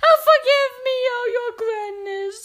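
very high, loud plea; miserable culprit crying. A narrator's reading. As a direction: squeal for forgiveness in terror. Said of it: "cried the miserable culprit".

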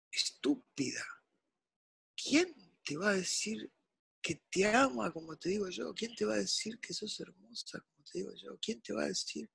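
soft, near-whispered pleading; hushed quote. Retold or imitated. Man quoting a lover's soft murmur to a woman. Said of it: "y dice, escucha".

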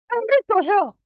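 wife waking, crying out in alarm; alarmed shout. Retold or imitated. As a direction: blurt out the terror. Wife announcing nightmare.

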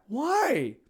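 squeaky high innocent question; high whiny why. Pretend. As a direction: ask it high, playing innocent. Pretend arrest scene.

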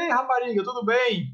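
high-pitched mock-friendly hail; playful greeting. [name] greeting a girl for fun, playful. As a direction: call out in fun, pitch lifted. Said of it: "eu me divertia".

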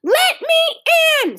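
put-on high, loud little-girl scream; screamed demand. A narrator's reading. Ramona locked out of the bathroom, screaming. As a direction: yell it from down the hall. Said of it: "screamed Ramona from the hall".

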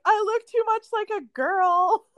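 high, mock-tearful whine; mock whiny crying. Friend complaining about the sparkly dress, in mock tears. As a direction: whimper it like a pouting crybaby. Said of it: "you were, like, crying about".

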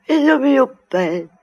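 slow, rising into a high tearful wail; mother sobbing tenderly over her son; weeping lament. Acted.

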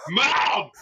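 high excited call to mom; excited shout of Mom. Retold or imitated. Boy calling his mom, excited shout. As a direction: kid bursting in to tell mom, excited yell. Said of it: "You know, I'm like".

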